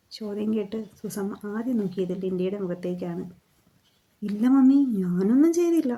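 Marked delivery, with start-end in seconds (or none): 4.2-5.9 high, loud denial to mummy; girl protests her innocence, higher and louder; protesting cry. A narrator's reading. Daughter denies to mummy: high loud protest. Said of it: "Linda തലയിൽ കൈവച്ചുകൊണ്ട് പറഞ്ഞു".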